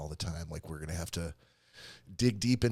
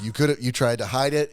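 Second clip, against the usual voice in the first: ordinary delivery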